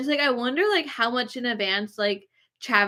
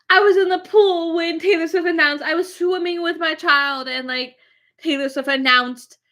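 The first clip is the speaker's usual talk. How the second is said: higher, louder parody of a fan gushing; mock excited fan; 6 semitones higher, 4 dB louder than usual. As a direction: imitate a breathless fan announcing news. Mocking fans freaking out online.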